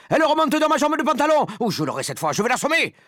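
high, loud panic with a threatening edge; frantic shouting. A narrator's reading. Man with a mouse up his trouser leg, shouting. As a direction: yell as the creature climbs your leg. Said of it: "cria l'homme".

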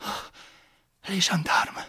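frightened whisper; the countess whispering as she spots the gendarmes. Acted. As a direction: breathe it out, terrified.

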